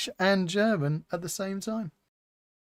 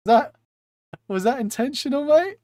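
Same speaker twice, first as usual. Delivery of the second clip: incredulous; 7 semitones higher, 6 dB louder than usual. Real, not acted. Teasing a chatter.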